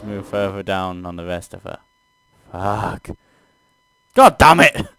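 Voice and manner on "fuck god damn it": loud, high cry of dismay; outraged shout. Real, not acted. Player shocked by the demon's anatomy, in a loud high shout. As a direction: yell it in disbelief. Outraged discovery mid-read.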